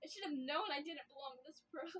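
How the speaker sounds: high, broken laugh-sobbing; hysterical laughing crying. Acted. Alone in the forest she breaks down, laughing and sobbing. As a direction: unravel between laughing and crying. Breakdown after killing Mel.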